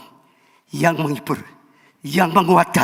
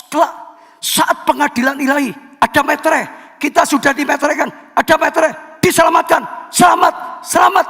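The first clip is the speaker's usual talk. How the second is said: climbing higher to a shout; triumphant preaching; 8 semitones higher, 3 dB louder, faster than usual. Real, not acted. Pastor proclaiming believers are sealed, triumphant shouted climax. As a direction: proclaim salvation with rising joy.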